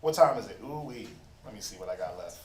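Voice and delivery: preacher checks his notes, muttering under his breath; muttered to himself. Real, not acted. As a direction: mumble it to yourself while checking your notes.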